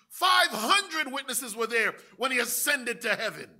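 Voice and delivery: loud, high, slow proclaiming; full-force preaching. Acted. Preacher voicing Paul pressing King Agrippa, shouting. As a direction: preach it out, building to a roar.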